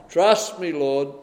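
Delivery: mock-confident Peter bragging to the Lord; cocky boast. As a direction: sell it like a brash man sure of himself. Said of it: "He was confident in himself".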